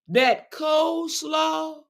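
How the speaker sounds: high, slow drawn-out proclamation; emphatic hype. Real, not acted. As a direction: announce it like a star. Hyping her signature dish.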